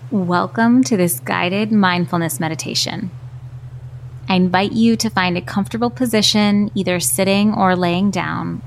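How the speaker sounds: calm, unhurried meditation voice with long pauses; guided meditation. Acted. Host guiding stressed marketers through mindfulness, calm and unhurried. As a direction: guide gently, leaving space after each instruction.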